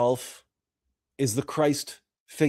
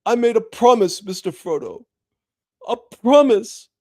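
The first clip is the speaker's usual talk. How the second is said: higher and louder, a vow pushed out; impassioned hobbit vow, loud and high; fervent Sam; 9 semitones higher, 5 dB louder than usual. Retold or imitated. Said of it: "looks Frodo in the eye and says".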